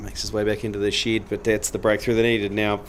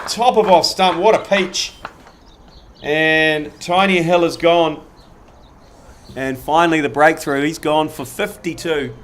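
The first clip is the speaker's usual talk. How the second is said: higher and louder, slowing to savour the wicket; excited commentary; 6 semitones higher, 7 dB louder than usual. Acted. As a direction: celebrate the dismissal like a live caller.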